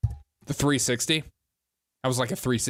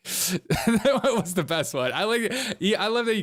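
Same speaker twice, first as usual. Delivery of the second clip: slow, higher, breaking up laughing; real laughter; 7 semitones higher than usual. Real, not acted. Praising the best clip, still cracking up laughing. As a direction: laugh all through the words.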